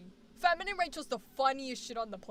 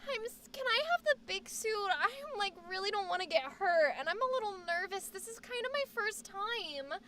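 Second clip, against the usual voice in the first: put-on high, soft, timid girly voice; feminine [name]; 5 semitones higher, 5 dB quieter than usual. Asking for the big paintball suit, feminine [name]. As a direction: play the nervous sweet girl, high and hushed. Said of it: "feminine [name]".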